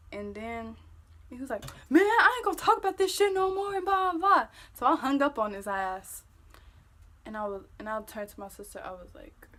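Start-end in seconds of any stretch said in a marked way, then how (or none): 1.9-4.5 high, loud, fast mock rant; hurried, whiny-loud imitation; mimicked angry refusal. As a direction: imitate an exasperated boyfriend brushing it off. Boyfriend's angry refusal, retold.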